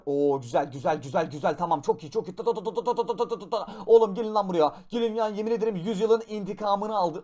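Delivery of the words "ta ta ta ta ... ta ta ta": rapid ta-ta-ta gun noises; mock gunfire. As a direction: rattle off imitated machine-gun shots. Firing gun in game.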